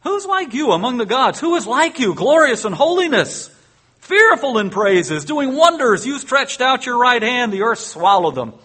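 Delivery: high, loud proclamation; sing-out the praise with fervour; a touch of jubilant song reading; exultant reading. Acted.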